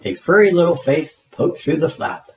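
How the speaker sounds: ordinary delivery, nothing marked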